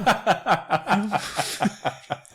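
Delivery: long helpless giggling fit; real laughter. Real, not acted. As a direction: crack up at your own bad accent. Cracks up at own failed accent.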